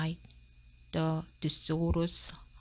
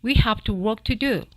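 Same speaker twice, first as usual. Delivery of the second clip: brisk scolding; 3 semitones higher, 10 dB louder, faster than usual. Acted. Dentist scolds fox.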